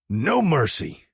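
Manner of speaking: high, slow shouted battle cry. A narrator's reading. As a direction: bellow it as they split up. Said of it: "I shouted as we parted ways".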